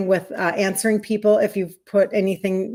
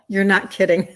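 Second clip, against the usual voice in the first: usual speaking voice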